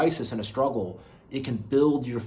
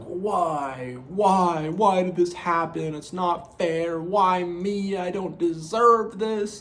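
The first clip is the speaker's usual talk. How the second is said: whiny self-pity; 5 semitones higher, much slower than usual. Retold or imitated. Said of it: "this mentality where I'm like".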